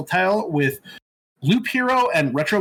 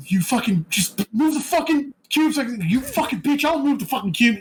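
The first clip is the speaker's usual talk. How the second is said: higher-pitched mock yell at a co-op partner; retold yelling; 8 semitones higher than usual. Player cursing at friend over moving cubes, mock yelling. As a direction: imitate exasperated gamer yelling without full volume. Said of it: "like, fucking yelling at".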